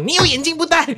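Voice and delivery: shrill high mom scolding; hammed-up nagging mother; mock scolding mom. The mother scolding the father for not wearing glasses, hammed-up high voice. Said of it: "我媽就開始罵他說".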